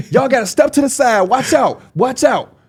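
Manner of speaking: high voice ordering people aside; imitating the usher clearing a path. Usher lady clears the crowd for them, high and commanding. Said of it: "She a little lady".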